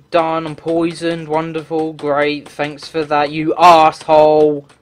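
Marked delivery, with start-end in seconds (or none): 3.3-4.7 slow, loud angry jab. Real, not acted. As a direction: spit the insult out. Sarcastic thanks to enemy.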